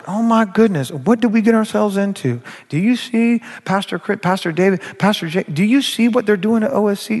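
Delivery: grumbling churchgoer. Acted. Churchgoer grumbling to another about the pastors, gossipy complaint. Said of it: "you're grumbling like".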